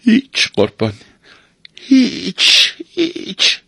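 mock-sorry answer climbing very high; regretful denial, pitch lifting on each repeat. A narrator's reading. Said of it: "با تأسف جواب داد".

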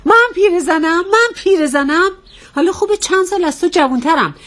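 offended wife challenging her husband; indignant. Acted. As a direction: throw it back in outraged disbelief. Offended at being called old.

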